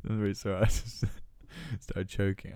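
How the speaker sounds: laughing apology after choking. Real, not acted. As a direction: talk through a laugh, a bit breathless.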